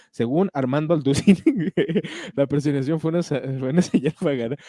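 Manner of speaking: giggling while reading aloud; laughing. Real, not acted. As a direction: try to read on through a laugh. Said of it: "me da risa".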